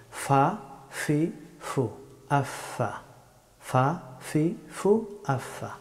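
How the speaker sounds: "ফা, ফি, ফু" with a true f; Arabic letter fa. Shown as a demonstration. Teacher drilling the letter fa, teeth-on-lip f. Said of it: "নিচের ঠোঁটের বিজা অংশ থেকে".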